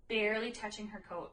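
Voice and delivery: usual speaking voice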